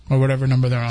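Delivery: normal talking voice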